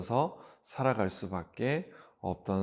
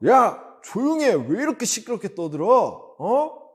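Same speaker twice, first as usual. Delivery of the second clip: high, raised-voice telling-off; angry scolding; 10 semitones higher, 6 dB louder than usual. Acted. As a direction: shut up a noisy beggar, annoyed. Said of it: "화를 내면서". (화를 내며 높은 목소리로 혼내기)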